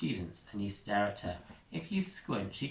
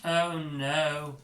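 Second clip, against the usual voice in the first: high, louder dismay; alarmed Oh no; 6 semitones higher, 4 dB louder than usual. Acted. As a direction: gasp it as it looms. Prongle sees the airship.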